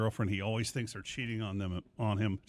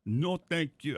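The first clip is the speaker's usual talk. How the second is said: bad German accent; 5 semitones higher, much slower than usual. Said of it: "I can't do German".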